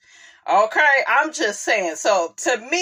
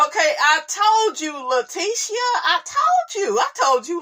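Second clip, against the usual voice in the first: exasperated told you; 9 semitones higher than usual. Real, not acted. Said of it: "scolding the cast member, pitch climbing".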